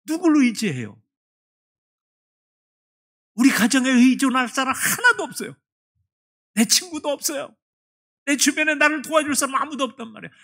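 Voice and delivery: high, loud pleading outburst; desperate protest. Retold or imitated. Deaconess crying out to God that no one helps, high and loud. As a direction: plead your loneliness to God. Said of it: "아니, 그럼 내가".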